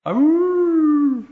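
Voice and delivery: long, high, loud howl; wolf howl. Retold or imitated. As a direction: howl like a distant wolf at the moon. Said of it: "I hear a wolf howl".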